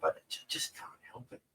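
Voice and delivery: sheepish whispered confession; guilty whisper. Real, not acted. Apologising to viewers for swearing, in a sheepish whisper.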